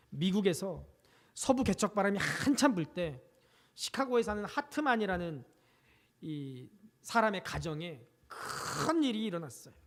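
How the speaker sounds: ordinary talk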